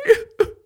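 a high tearful sob. Acted. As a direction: sob out loud as the apple. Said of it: "she started to cry".

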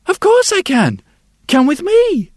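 put-on high, loud happy child; a little girl who can't wait to show him; eager little girl. The brightened girl answers the sculptor eagerly. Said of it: "her face brightened".

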